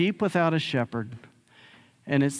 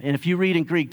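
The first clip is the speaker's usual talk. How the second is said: ordinary delivery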